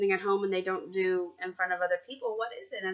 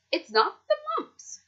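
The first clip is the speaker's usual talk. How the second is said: much higher, squeaky doctor voice. Acted. Doctor Grop ruling out the mumps in a squeaky voice. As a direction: pitch it up for the first specialist.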